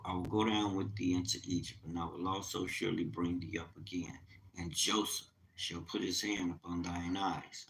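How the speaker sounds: quiet solemn scripture voice; scripture reading. Acted. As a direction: read the verse with reverence. Reads scripture aloud.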